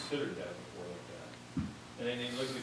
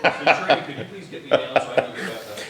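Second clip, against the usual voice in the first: much louder, hammed-up call for help; joking plea; 4 semitones higher, 15 dB louder than usual. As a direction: call out a mock request over the laughter.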